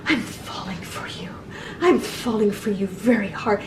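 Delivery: soft, unvoiced imitation of a tearful girl; mock-whisper her gushing confession; hushed, almost-crying mock confession; breathy lovestruck whisper. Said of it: "she's like".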